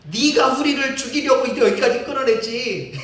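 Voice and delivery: loud, high accusation; mock angry accusation; accusing wail. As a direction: hurl the blame at Moses. People wail and blame Moses.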